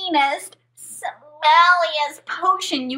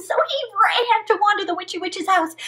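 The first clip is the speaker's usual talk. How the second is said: plain reading voice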